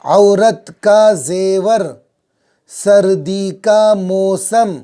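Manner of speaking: phrases read very slowly and clearly; drawn-out dictation reading; slow model reading. Shown as a demonstration. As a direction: read each pair of words slowly for pupils. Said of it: "छोटे छोटे जुमले".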